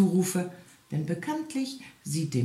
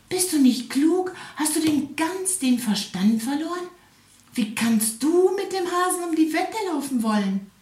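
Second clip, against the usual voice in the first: much higher, louder exasperated scold; scolding wife; 12 semitones higher, 3 dB louder than usual. A narrator's reading. The wife scolds her husband for the bet: much higher, louder. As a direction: tell him off, shrill with disbelief. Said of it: "schrie dem Igel seine Frau".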